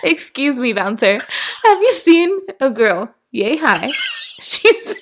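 high, laughing tipsy plea; mock drunk search. Pretending to describe her drunk sister to a bouncer, laughing. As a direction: giggle through a drunk girl's description. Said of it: "Kayla and I are both drunk".

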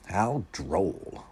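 understated, drawn-out moan. A narrator's reading. Wendell scorning the author's description, moaning. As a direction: groan it in mild disdain. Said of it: "moaned Wendell".

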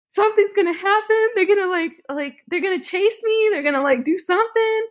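high-pitched imitation of panicked crying; retold hysterical crying. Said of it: "hysterically crying".

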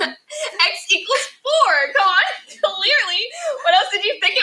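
high, loud, laughing know-it-all; mock know-it-all. Girl mimicking the classmate who thinks math is easy, high, loud and laughing. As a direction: shout the obvious answer like everyone's dumb.

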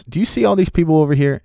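high-pitched retold complaint; worked-up mock grievance; venting. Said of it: "he just starts venting to me".

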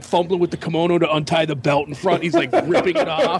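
ordinary talk

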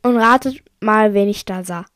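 plain reading voice

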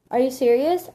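loud, high, thrilled disbelief; excited freak-out. Acted. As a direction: blurt it out in excitement. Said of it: "I freaked out".

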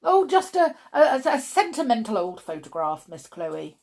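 stumbling, high and loud at first; flustered. A narrator's reading. Mister Stink brushing off the photograph, flustered. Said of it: "becoming increasingly flustered".

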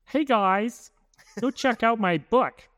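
high, slow, bubbly influencer pitch. Pretend. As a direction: sell it like a YouTuber plugging merch. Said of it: "Your influencer voice?".

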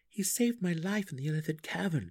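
high-pitched, sharp comeback; sharp retort. A narrator's reading. Drizzt defends Clacker to Belwer, sharp retort. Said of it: "Drizzt retorted sharply".

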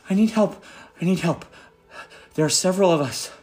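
put-on a young gnome bursting with panic and relief; frantic gnome. Young gnome finding the party at dawn, frantic high cries. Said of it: "the gnome comes up and says".

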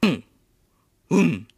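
usual speaking voice

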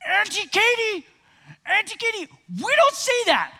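high-pitched imitation of a scolding three-year-old; Banner. Preacher imitating his shocked little nephew correcting his aunt, high child voice. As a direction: squeak it out like an earnest little boy. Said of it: "he's got this distinct voice".

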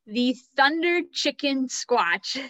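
breaking into laughter on the name; laughing. Real, not acted. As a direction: laugh through the silly name, slowed. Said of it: "I can't say that name without laughing".

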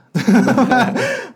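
bursts into high laughter; loud laugh. Real, not acted. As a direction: laugh it off loudly. Loud laugh after modest reply.

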